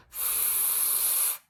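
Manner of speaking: long, breathy "f" with no voice; just air through lip and teeth for "f", drawn out; stretched-out f. Shown as a demonstration. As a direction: hold "f" as a steady stream of breath. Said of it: "sounds that you can stretch out".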